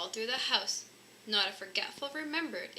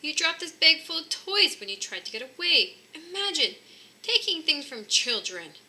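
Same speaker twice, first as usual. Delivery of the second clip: higher, louder indignant telling-off; wife accusing Santa of stealing toys; 7 semitones higher, 7 dB louder than usual. Acted. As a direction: scold the thief with outrage.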